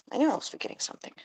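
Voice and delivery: fast breathy whisper to herself; whispered to self. Real, not acted. Muttering to herself while looking for straw, whispered. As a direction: hiss it under your breath, quick.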